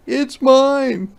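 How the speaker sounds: high, loud, slow demand; a boy insisting on his camera; loud high boy's demand. Acted. Martin quarrel.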